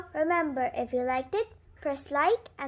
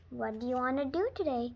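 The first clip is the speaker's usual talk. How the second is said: ordinary delivery, nothing marked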